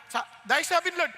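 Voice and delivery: normal talking voice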